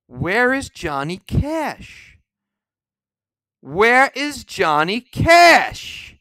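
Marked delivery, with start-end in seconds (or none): 3.6-6.2 loud, slow, furious demand; angry shout. Real, not acted. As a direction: yell the question out at the list makers. Indignant rant about missing artists.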